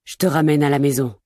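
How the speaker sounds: tender reassurance. Acted. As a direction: soothe her gently, it's over. Amir comforts her.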